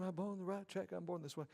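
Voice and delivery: high, soft mock sing-along; imitating senior adults. Preacher mimics seniors working out to [name], high soft imitation. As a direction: lightly mimic gym-goers quietly joining in. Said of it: "watching senior adults around the room".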